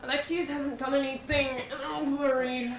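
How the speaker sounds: worried cry. Acted. Player voices worried character loudly.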